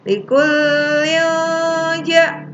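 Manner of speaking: "Likulli ja" slow and chanted high; "Likulli ja" on a sustained note, n blurred into a hum; ikhfa recitation. Shown as a demonstration. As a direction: draw out the hidden n before "ja". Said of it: "hukumnya disamarkan atau ikhfa".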